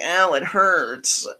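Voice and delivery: slow, high mock whimper; mock whine. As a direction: whine it slowly like a baby. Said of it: "It's like".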